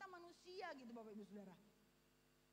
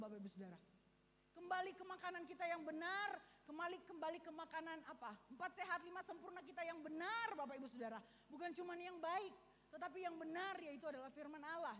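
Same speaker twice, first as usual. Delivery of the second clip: louder, driving sermon build; preaching at full force; 3 semitones higher, 7 dB louder than usual. Acted. Pastor urges the congregation back to God's word, preaching hard. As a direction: press the point home to the church.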